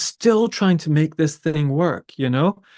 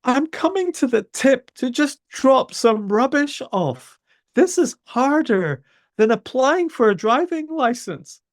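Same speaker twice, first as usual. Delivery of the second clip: high-pitched exasperated protest, voice pushed up; exasperated retort; 9 semitones higher than usual. Retold or imitated. To the council tip worker, a high exasperated protest. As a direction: throw up your hands at the absurd paperwork. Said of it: "And I'm like".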